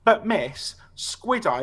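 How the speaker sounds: mock pleading protest. Pupil excusing himself to the teacher, mock pleading. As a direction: imitate a pupil appealing to miss.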